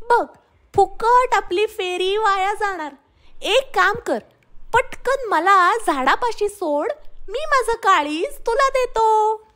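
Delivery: high, quick-witted monkey voice; high monkey voice spinning a trick; clever monkey. Acted. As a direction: bluff the crocodile with easy confidence.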